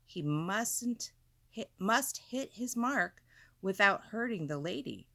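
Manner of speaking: plain reading voice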